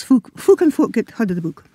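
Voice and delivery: usual speaking voice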